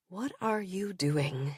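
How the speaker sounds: put-on slow whispered Russian accent. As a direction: breathe it in a soft Russian accent, aroused. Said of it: "he says in his Russian accent when he is aroused".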